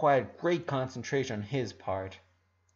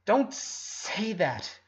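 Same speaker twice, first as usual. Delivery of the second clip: horrified; 6 semitones higher, much slower than usual. Acted. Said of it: "horrified at her words".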